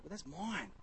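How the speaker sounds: quiet, mock-plaintive protest; possessive protest. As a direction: softly imitate a put-out child. Child protests others using his things.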